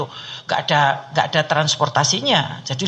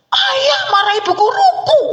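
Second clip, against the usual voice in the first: put-on high, loud child complaint; child's voice; 5 dB louder than usual. Child complaining to her father, high and loud. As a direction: whine it like a upset little kid.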